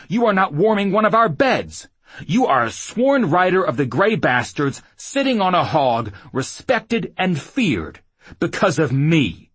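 loud, high, forceful rant; angry shouting. Acted. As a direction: hammer each point home. Man berating woman in a fight.

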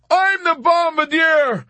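high, slow, full-voiced shout; crying back. A narrator's reading. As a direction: yell back to make him hear. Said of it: "cried back at him".

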